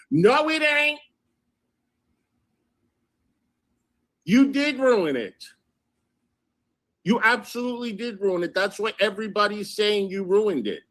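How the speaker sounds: high-pitched, exasperated contradiction; insistent and scolding, pitched high; indignant rebuttal. Real, not acted. Rebutting the message she read out.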